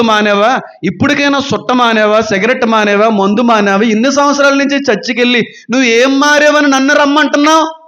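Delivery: mock skeptic's jab; challenging question. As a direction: throw the question back as an outsider. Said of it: "అని ప్రశ్నిస్తే".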